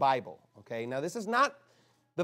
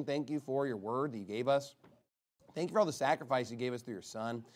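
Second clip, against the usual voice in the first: lower, softer praying voice; closing prayer; 5 semitones lower, 5 dB quieter than usual. Real, not acted. Preacher thanking the Father for creation, quiet closing prayer. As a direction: pray aloud in a subdued, sincere tone.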